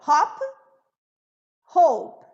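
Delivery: "Hop" short, then "hope" long and loud; short and long vowel. Shown as a demonstration. Teacher corrects herself and reads the pair, long o loud. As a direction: contrast "Hop" and "hope" clearly. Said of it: "aqui tá inverso".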